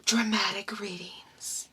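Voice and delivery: whispered, slow; dramatic whisper. Acted. Co-host echoes the segment name in a dramatic whisper. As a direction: breathe it out for drama.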